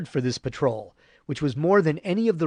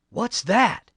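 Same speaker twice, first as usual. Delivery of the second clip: ordinary delivery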